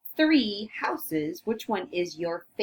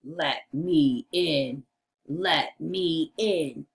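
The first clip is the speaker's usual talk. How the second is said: low, slow storybook wolf; deep, deliberate wolf voice; big bad wolf voice; 5 semitones lower, slower than usual. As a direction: play the wolf demanding entry, drawn out. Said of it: "your big bad wolf voice".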